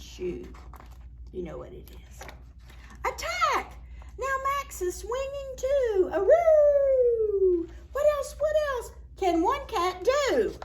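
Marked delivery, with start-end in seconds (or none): 3.0-3.8 high excited cry; excited battle cry. Acted. Max pouncing on the string, high excited cry. Cat charging in story. 4.2-10.5 excited. Acted. Excited story climax.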